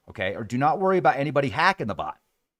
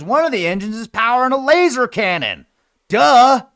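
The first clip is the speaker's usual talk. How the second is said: excited; 10 semitones higher, 9 dB louder than usual. Real, not acted. Excited about laser cannon.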